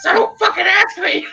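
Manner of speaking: very high squawking parrot. Acted. Parrot answering its owner back, high squawky parrot voice. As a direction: screech it out like a parrot, squawks between phrases. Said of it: "And then he goes".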